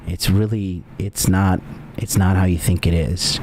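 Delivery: low, soft, coaxing voice; calm her down like a smooth talker; low soothing sweet talk to an upset ex; smooth reassuring. Pretend. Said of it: "And I'd be like".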